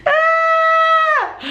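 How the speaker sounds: long, very high sustained shriek; hammed-up scream of terror; mock scream. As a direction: imitate a frightened child screaming. Re-enacting kids' frightened screams.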